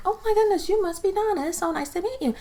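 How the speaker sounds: high, sugary greeting voice; friendly friend. Retold or imitated. The good friend greets Donna, high and gushing. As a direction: gush over meeting her like a sweet friend. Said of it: "she's being friendly".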